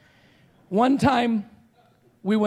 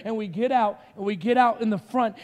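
usual speaking voice

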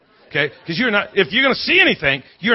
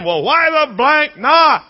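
imitated high, loud, drawn-out yell; screaming husband; 9 semitones higher, 5 dB louder, much slower than usual. Husband screaming at his wife on the stairs, hollering. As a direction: stretch out a mock holler. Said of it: "he's screaming at his wife".

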